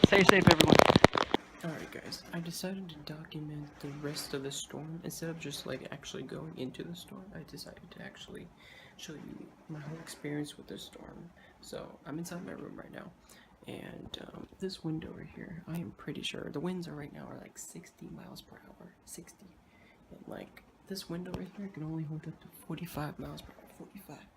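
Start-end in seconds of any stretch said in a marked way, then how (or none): 1.6-17.4 hushed talk indoors; quiet voice. Real, not acted. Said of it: "quiet indoor narration". 17.4-19.5 whisper. Real, not acted. Whispering the wind speed. 20.1-23.7 hushed talk indoors; quiet voice. Real, not acted. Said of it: "quiet indoor narration". 23.8-24.2 whisper. Real, not acted. Whispered number.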